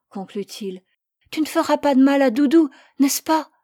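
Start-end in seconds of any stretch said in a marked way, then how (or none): 1.3-3.5 put-on high, loud pleading little boy; child's voice. Boy begging his parents to spare the lamb, high and loud. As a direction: plead like a small boy defending his pet.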